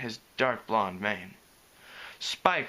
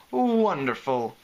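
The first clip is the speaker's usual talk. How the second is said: sarcastic; 2 semitones higher, 4 dB louder, slower than usual. A narrator's reading. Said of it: "with expansive sarcasm".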